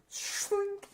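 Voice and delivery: high, hissy blade-sliding noise; drawn-out metallic slink effect; imitated blade sound. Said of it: "and just goes".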